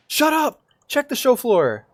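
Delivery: high, loud shout at the screen; sudden yell. Real, not acted. Gamer yelling at the screen in a sudden loud shout. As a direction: bark it out suddenly.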